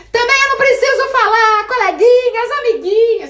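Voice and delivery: overexcited clown. Shown as a demonstration. Teacher showing students a clownish, overexcited greeting, hammed-up high loud. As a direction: overdo it like a kids' show host. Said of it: "Gente, que palhaça".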